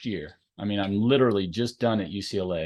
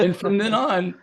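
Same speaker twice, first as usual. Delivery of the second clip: still laughing; 11 semitones higher, 4 dB louder than usual. Real, not acted.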